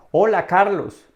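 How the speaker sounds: high, loud model greeting; hearty greeting. Shown as a demonstration. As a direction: call out a big hello to a friend. Models greeting for learner's turn. (saludo efusivo)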